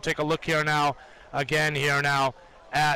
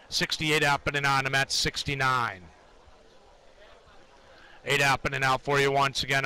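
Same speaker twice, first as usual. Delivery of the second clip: trading floor price calls; 2 semitones lower than usual. Acted. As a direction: call the bids in a monotone chant. Live price ladder callouts.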